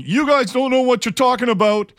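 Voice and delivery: high, loud wrestler catchphrase; loud wrestling promo. Retold or imitated. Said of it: "in a loud voice".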